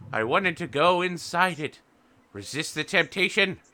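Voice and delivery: put-on high old lady rabbit voice; old woman rabbit. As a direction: caution her like a doting grandmother. Old woman rabbit cautions dearly.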